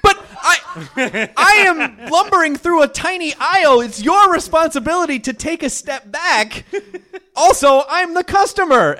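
high, slow indignant protest. Retold or imitated. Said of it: "And I'm like".

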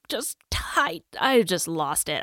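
ordinary delivery

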